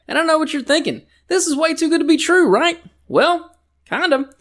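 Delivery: high, squeaky pitchman aside; high-pitched ad aside. Acted. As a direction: pitch way up for the sly admission. Mock ad turns confiding.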